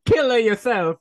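high, loud cartoon Italian accent; Luigi. Italian plumber cursing a rival racer, loud cartoon accent. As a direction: shout it as an angry Italian plumber. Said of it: "Luigi did tell you to kill yourself".

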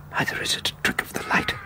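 hushed, awed dread; frightened whisper. Acted. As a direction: whisper what he sees. Seeing the ghost boat.

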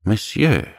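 a touch of wounded pride; proud. Acted. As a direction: answer with mild dignity. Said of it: "replied Dante proudly".

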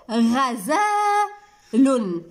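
very slow, drawn-out غزال; word stretched out for small children; stretched syllables. Shown as a demonstration. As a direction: hold the long vowel in غزال.

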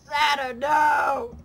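loud high wailing Santa no; anguished shout. Real, not acted. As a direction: cry out in mock despair. Santa crashes again.

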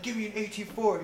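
high, loud impatient order; retold pissed-off demand. Narrator to his gunner mid-firefight, pissed-off demand. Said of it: "I was so fucking pissed off".

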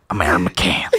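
low comic voice; Dave Chappelle impression. Comic introducing himself in a stand-up's voice, low. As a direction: introduce yourself deep and low. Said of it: "I'd take Chappelle's".

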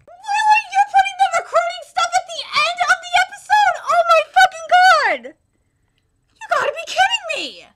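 high, loud disbelieving yell with a cry in it; outraged shout. Real, not acted. Anime reviewer yelling at the studio over the episode, outraged. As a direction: explode in angry disbelief.

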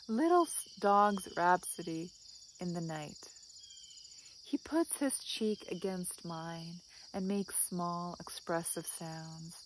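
soft, measured recital falling away at line ends; poem reading. Acted. Vlogger reads the dog Percy's advice, soft poem recital. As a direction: recite it gently, pausing after each image.

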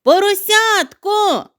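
slow, high, loud drawn-out call; long call. Acted. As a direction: stretch the name out across the snow. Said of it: "загукав він".

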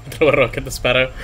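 ordinary talk, nothing marked

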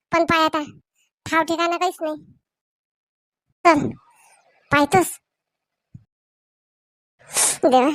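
ordinary delivery

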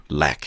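"락" as English leg, the vowel coming down from above; falling vowel. Shown as a demonstration. Teacher models the simple vowel, falling "락". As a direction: drop through the vowel of "락". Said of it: "위에서 내려와야 돼요".

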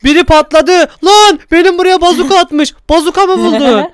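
high, loud startled outcry; suddenly under fire, startled and loud; excited alarm. Real, not acted. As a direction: yelp it out as the blast hits. Someone fired a bazooka at him.

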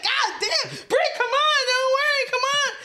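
high, pleading yell to calm her; pleading with friend. Retold or imitated. Friend begging crying Brittany to come away, high pleading shout. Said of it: "I was like".

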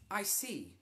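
stern teacher. Acted. Teacher responding coldly.

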